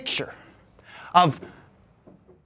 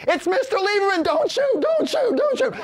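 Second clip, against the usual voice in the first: much higher, rising panic; captain yelling to hold fire; panicked police captain; 10 semitones higher than usual. Acted. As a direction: call off the shooters in alarm. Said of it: "The police captain was like".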